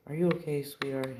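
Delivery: high, sing-out motherly concern; doting nurse. Retold or imitated. Healer checks on the fallen hero, doting concern. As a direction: pitch it well up, fussing over a patient.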